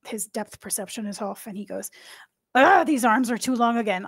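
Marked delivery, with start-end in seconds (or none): 2.5-4.1 flustered baker. Acted. Baker nearly hits player, exclaims.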